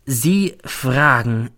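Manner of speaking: very drawn-out, weighty words; slow, word by word. A narrator's reading. Colleague stops in rain to answer, slow and pointed. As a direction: stretch each word, pointedly. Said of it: "sagt dann langsam".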